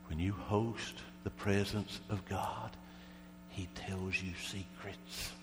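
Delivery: slow, soft preacher's delivery; hushed preaching. Real, not acted. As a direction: let it sink in slowly and softly.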